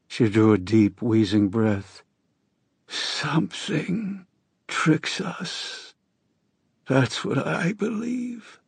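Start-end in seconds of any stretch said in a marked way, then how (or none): 2.9-8.6 high, slow hoarse voice of a sick woman; a dying woman's rasping plea. A narrator's reading. Narrator voices dying Astrid.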